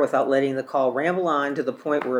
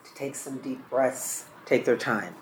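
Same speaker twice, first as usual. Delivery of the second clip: softly modelled gentle encouragement; 8 dB quieter than usual. Shown as a demonstration. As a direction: drop the volume, gently coaxing. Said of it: "just softly and gently encourage them".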